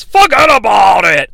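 loud announcer's yell; Vince. Acted. Commentator calling a big clothesline, yelling. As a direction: holler it like a ringside commentator. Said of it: "and Vince yells".

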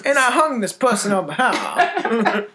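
bad Southern voice, goofing; mock Civil War Southern. Joking Civil War character in a mock Southern accent. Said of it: "Civil War times".

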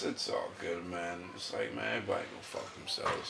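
low mutter. Real, not acted.

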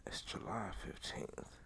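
unvoiced whisper, slow, checking the date; whispered to self. Real, not acted. Checking the date before the shout-out, whispered. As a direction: mutter the date under the breath.

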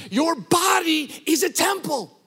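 high, loud ringing proclamation; preaching at full force. Real, not acted. Preacher declares the body a temple, full-force preaching. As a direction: preach it out to the back row.

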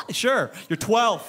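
laughing, dismissive scoff; mock annoyed. Pastor mocking his own scoff at the boy, laughing. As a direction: brush off the kid with a laugh. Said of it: "I'm, like, annoyed".

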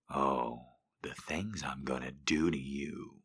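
low breathy whisper. Acted. Lover whispering a tease to his girl. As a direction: whisper it as a promise.

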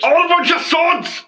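high, shouted mock Scottish outburst; Scottish accent. Co-host heckling about the English, shouted Scottish accent. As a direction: holler it as a comic Scot. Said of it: "with that Scottish accent".

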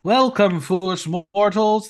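spooky haunted host greeting; booming villain welcome. Pretend. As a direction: boom it out like a ghost host. Haunted hotel host intro.

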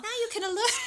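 very high, loud mock Cockney; Piggy, Cockney accent. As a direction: squeal it in hammed-up Cockney. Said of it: "I don't know why he's Cockney now".

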